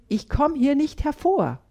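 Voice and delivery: put-on stubborn child refusing from her hiding place; energetic. A narrator's reading. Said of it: "sagt sie energisch".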